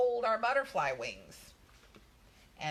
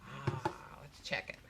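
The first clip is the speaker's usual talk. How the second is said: softer once the dryer stops; quiet; 4 semitones lower, 5 dB quieter than usual. Real, not acted. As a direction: drop back to a soft voice. Dryer off, repeated softly.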